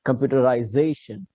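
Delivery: computerization with the weight on "za"; accent on that syllable. Shown as a demonstration. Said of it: "Put accent on that particular syllable".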